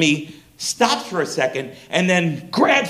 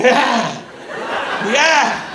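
dazed agreement; 7 semitones higher than usual. Retold or imitated. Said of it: "And I'm like".

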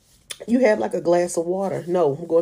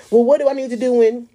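louder, faster pleading question; louder, faster anxious plea; louder urgent plea; 6 semitones higher, 6 dB louder, faster than usual. Retold or imitated. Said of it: "pleading louder, faster".

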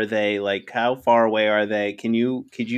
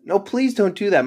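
higher-pitched, mildly alarmed plea; pleading; 7 semitones higher than usual. Acted. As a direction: beg them not to do it. Pleads against backpack used as shield.

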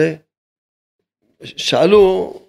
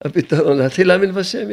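usual speaking voice

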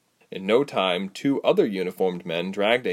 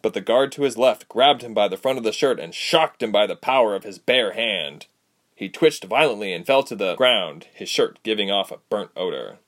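ordinary delivery, nothing marked

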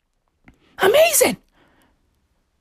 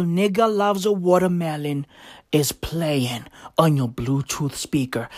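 hushed whisper; 9 semitones lower, 5 dB quieter than usual. Shown as a demonstration. Comic whispering the song title to the crowd. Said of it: "Imagine enjoying a bowl".

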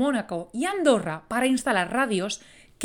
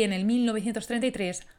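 usual speaking voice

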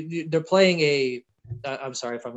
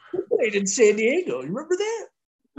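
put-on high, louder creaky old-timer voice; mock old-timer; 11 semitones higher, 4 dB louder than usual. Old fan teasing young kids about San Diego, high mock old-timer. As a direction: quaver it out like a grandpa reminiscing.

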